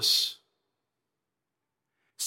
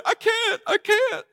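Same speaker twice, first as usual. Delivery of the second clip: high-pitched mock-tearful wail; hammed-up weepy cry; imitated crying; slower than usual. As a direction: imitate someone too choked up to go on. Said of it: "He began crying like his mama just died".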